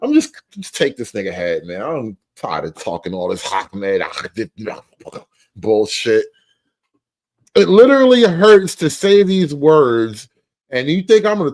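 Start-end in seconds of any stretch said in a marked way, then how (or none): 0.0-6.2 frustrated mock speaker.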